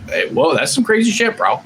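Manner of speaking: a light jump up into a mock stoked bro; crazy bro voice. Shown as a demonstration. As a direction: toss it off like a hyped surfer dude. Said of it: "I say crazy bro shit".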